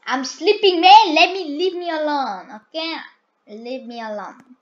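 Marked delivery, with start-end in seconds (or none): 0.1-2.9 higher, loud grumpy yell; high loud grumpy kid just woken; annoyed shout. Pretend. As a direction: yell at them to leave you alone.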